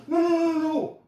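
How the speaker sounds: high, loud panicky string of noes; panicked no. Retold or imitated. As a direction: flap and squeal in mock panic. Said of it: "and then I go".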